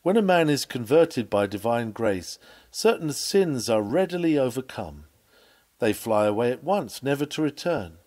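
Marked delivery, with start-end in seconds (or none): none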